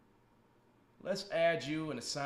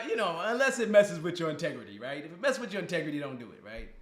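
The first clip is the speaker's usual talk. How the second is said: normal talking voice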